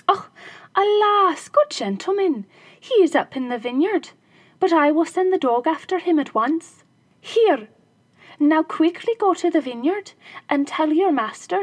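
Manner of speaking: high, loud flustered wife; wife's voice. Acted. Wife fooling the robbers with the dog, mock flustered. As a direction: play the innocent housewife.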